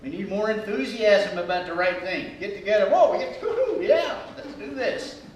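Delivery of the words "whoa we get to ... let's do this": much higher, whooping excitement; enthusiastic cheer. Acted. Group getting together, whooping with enthusiasm. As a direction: whoop like a hyped teammate.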